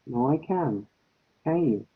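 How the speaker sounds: usual speaking voice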